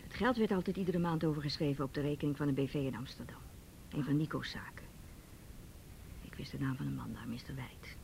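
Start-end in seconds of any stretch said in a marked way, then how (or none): 2.5-8.0 softer confiding whisper; hushed confession. Acted. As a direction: whisper the secret. Secret family money talk.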